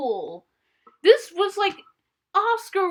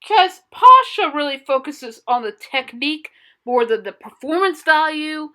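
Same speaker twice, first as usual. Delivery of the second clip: usual speaking voice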